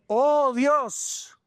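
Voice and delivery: rising fervent plea; impassioned preaching. Acted. Preaching climax.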